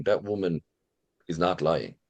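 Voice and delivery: normal talking voice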